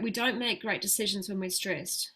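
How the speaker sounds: ordinary talk, nothing marked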